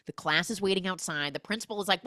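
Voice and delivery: ordinary delivery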